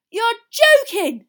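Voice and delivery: very high, drawn-out incredulous shout; loud disbelief. A narrator's reading. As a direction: call it out loudly across the hall. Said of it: "said Fred Weasley loudly".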